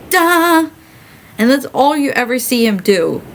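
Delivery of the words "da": a sudden high sung blast "Da!"; mimicking a dramatic musical hit; mock orchestra hit. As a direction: belt out a one-note orchestral stab. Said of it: "and then the music goes".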